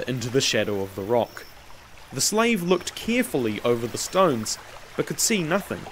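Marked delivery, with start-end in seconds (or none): none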